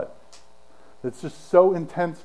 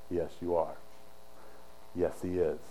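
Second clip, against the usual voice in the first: very low, soft answer; hushed, low-pitched yes; quiet affirmation; 10 semitones lower, 12 dB quieter than usual. Retold or imitated. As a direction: confirm it gravely under the breath. Quietly answering the rhetorical question.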